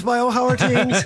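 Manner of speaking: jokey mock Irish accent greeting a Kerry caller; terrible put-on accent. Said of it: "this boy's terrible accent here".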